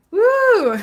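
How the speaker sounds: long, high-pitched whoop; airy sustained "wooo"; high woo. Real, not acted. Reader moving on to the next sign, high woo.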